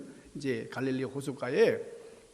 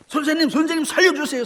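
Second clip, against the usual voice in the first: 높고 크게 살려 달라고 부르짖기; 위급해서 목청껏 매달리는 제자; 다급한 외침; 13 semitones higher, 8 dB louder, faster than usual. Acted. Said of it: "너무나 다급하니까요". (다급하게 내지르는 비명 같은 외침)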